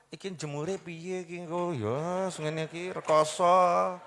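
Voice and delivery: drawn-out, put-on grumbling moan; mock lament. Someone fretting over drying a wet chair, mock lament.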